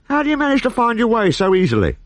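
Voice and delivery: high admiring wonder. A narrator's reading. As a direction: marvel at his skill. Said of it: "said Asterix admiringly".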